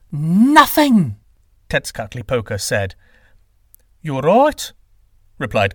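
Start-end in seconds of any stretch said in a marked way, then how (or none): 0.1-1.2 high, loud single-word exclamation; triumphant loud point-making; emphatic sky god. Acted. 4.0-4.7 raised pitch with rising end; surprised agreement, lifting at the end; conceding wind god. Acted.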